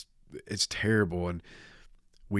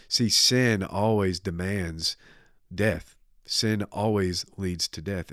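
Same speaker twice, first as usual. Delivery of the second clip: normal talking voice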